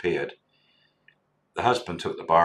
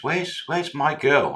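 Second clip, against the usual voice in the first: put-on higher, slow, eager asking for his girl; mock eager Leon; 9 semitones higher, 3 dB louder, slower than usual. Bar friend asking the manager for his girl, high and slow. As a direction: stretch it out, hopeful. Leon's eager question retold.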